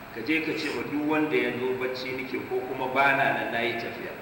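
ordinary delivery

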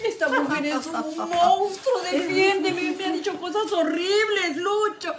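high-pitched complaint to her son; wailing plea. Acted. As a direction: beg him to take your side, wailing. Begging son to defend her. (súplica lastimera y aguda)

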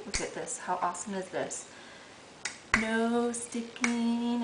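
ordinary talk

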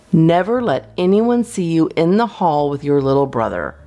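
usual speaking voice

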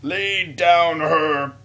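hammed-up, very drawn-out cry; mock Greek. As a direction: lightly play a Greek, stretching each word. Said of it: "a Greek and it was like".

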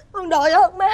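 nói to, nức nở biết ơn; bật khóc tạ ơn má; khóc đội ơn. Acted. Con dâu khóc cảm ơn. (con dâu nức nở cảm ơn má)

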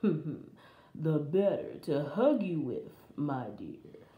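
low, slow wolf voice; low wolf posing as Granny; sly wolf. Acted. Disguised wolf answers Little Red.

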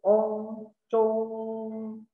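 high level ONG, then CHUNG held long; sounding it out. Shown as a demonstration. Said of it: "bacanya Chung". (eja "Ong" lalu "Chung", tinggi dan panjang)

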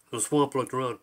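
ordinary delivery, nothing marked

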